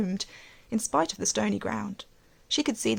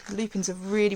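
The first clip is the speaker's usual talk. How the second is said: usual speaking voice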